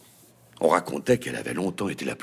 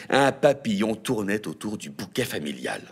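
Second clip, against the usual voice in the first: ordinary delivery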